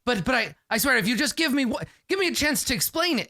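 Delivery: pleading nervous inventor. Acted. Nervous inventor begging Ral for a chance, high and frantic. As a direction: squeak out a panicked plea.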